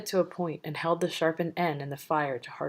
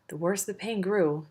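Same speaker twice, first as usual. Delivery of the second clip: ordinary delivery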